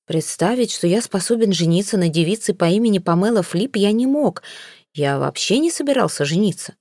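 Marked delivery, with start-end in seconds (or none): none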